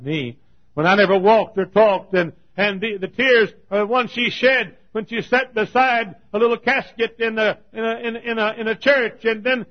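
loud and high, rushing, then a ringing question; preaching at full force. Acted. Preacher to his congregation, asking about the ringed men, preaching loudly. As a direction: cry it out over the crowd. Retells the mother's tears.

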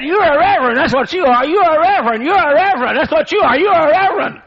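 high, loud, triumphant shouting; shrill accusation. Retold or imitated. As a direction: shout it so the whole plane hears. Woman shrieking her accusation.

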